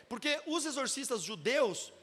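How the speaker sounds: normal talking voice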